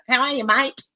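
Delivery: hammed-up Aussie accent, rising at the end; exaggerated Australian. Shown as a demonstration. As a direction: play up your own Australian twang. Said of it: "that was very Australian".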